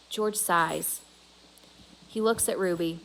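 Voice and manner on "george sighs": usual speaking voice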